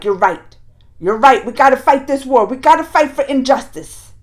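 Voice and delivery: loud, fired-up resolve; worked up. Retold or imitated. Arjun fired up agreeing to fight the war, loud. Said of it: "he got Arjun worked up".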